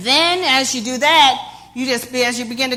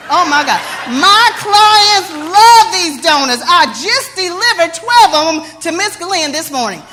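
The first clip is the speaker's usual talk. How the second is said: gushing sales pitch with emphatic bursts; excited donut lady. Acted. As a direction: rave about your donuts, punching key phrases. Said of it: "I sprinkled them up with excitement".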